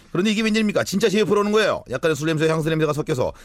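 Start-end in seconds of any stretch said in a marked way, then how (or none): none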